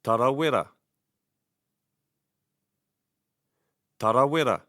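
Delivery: "Tarawera" stressed on TA; stress first syllable. Shown as a demonstration. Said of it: "stress the first syllable of the word".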